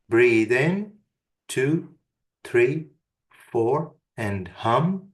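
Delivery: slow counted breathing cue; guided breathing count. Shown as a demonstration. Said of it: "inhale for four and exhale on a low, gentle hum".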